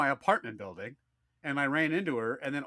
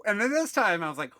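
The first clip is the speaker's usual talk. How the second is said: normal talking voice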